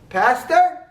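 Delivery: high, lightly voiced old woman calling out. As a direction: call out gently from the back of the church. Voice at back of church.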